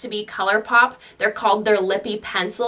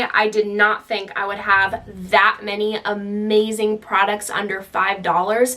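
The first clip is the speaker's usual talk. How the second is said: ordinary talk, nothing marked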